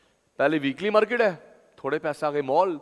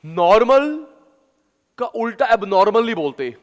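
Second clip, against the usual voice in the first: high, loud teaching recitation; punchy, raised-pitch mnemonic; loud memory drill; 5 semitones higher, 10 dB louder than usual. Shown as a demonstration. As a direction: belt it out for the class to memorise. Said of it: "आराम से, आराम से".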